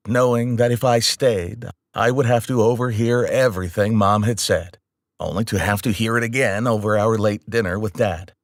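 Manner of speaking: usual speaking voice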